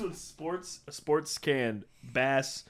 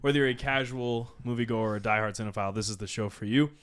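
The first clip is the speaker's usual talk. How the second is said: podcast host intro; 5 dB louder than usual. Acted.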